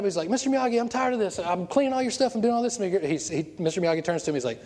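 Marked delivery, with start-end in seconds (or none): 0.3-2.8 high-pitched mock griping; high, complaining student voice; fed up. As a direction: imitate an exasperated kid airing a grievance. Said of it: "fed up".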